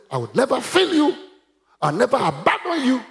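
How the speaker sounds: high, forceful quoted promise; emphatic proclamation. Retold or imitated. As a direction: declare the promise high and firm. Preacher proclaims God's promise.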